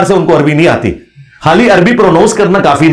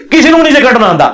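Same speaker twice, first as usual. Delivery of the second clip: normal talking voice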